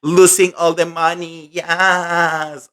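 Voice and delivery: high, airy mock of Kim's carefree boast. Vlogger mocking Kim's gambling boast, high airy mimicry. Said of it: "I could not sit down there with a straight face and say".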